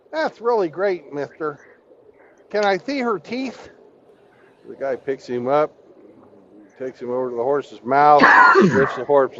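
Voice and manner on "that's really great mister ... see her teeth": high, lisping little-man voice with a lisp on "mister"; lisping midget voice. Retold or imitated. Telling the midget joke to Hammy, high lisping midget voice. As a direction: pitch up and lisp like the hair-lip midget. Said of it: "So he goes".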